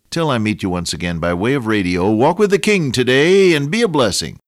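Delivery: loud, rising broadcast farewell; radio sign-off. Acted. Radio preacher signs off to his listeners, loud and rising. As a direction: send listeners off warmly and loudly.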